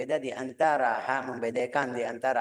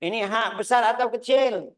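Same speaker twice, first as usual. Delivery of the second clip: higher, louder questioning student voice; puzzled Indonesian student; 8 semitones higher, 6 dB louder than usual. Retold or imitated. Teacher imitating Indonesian learners asking about ha, high questioning voice. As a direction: pitch it up like a pupil asking the sheikh. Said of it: "orang Indonesia bilang".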